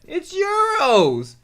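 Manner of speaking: high, slow, loud nasal mimicry; slow, high mocking voice; mock scammer. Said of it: "scammer answers currency question".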